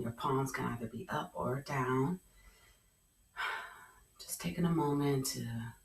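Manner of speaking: calm, hushed yoga guidance; guided meditation. Acted. Leads a guided relaxation.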